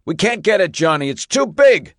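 high, loud yell over the noise; yelling over engines. Acted. Fire chief to Johnny over roaring engines, loud yell. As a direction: shout to be heard over the pumps. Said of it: "the fire chief yelled over the hum".

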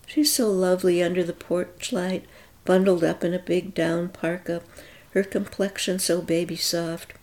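ordinary delivery, nothing marked

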